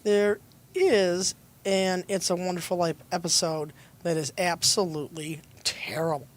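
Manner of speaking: ordinary talk, nothing marked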